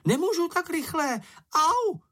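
high, squeaky voice of a tiny creature being dragged; squeaky critter squeal; squealing little creature. A narrator's reading. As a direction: yelp like something small in pain. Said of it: "dál se do vřískání".

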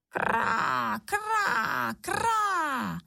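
high, harsh crow caws; cawing crows. A narrator's reading. Crows call from high above, cawing. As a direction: caw like a crow overhead. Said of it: "zaznělo ve výši".

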